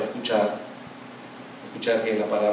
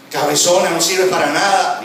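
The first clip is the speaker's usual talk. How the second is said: loud, raised mock-quarrel voice; acted insult; 5 semitones higher, 9 dB louder than usual. Spouse throwing insults at partner, loud mock anger. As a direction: hurl it out like a marital jibe.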